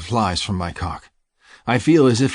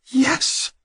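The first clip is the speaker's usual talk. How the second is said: passionate cry. Acted. Lover crying out in passion.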